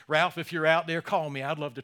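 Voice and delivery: loud call to someone in the crowd. Real, not acted. Pastor calling out to his old boss, loud and open. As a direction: raise the voice and call him out by name.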